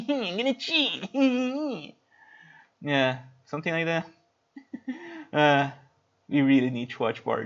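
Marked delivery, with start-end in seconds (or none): 0.1-1.9 higher, slow put-on Mike voice; lightly voiced goofy Mike; Mike impersonation. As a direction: drag it out slowly in a silly high voice. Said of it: "my best motherfucker Mike impersonation".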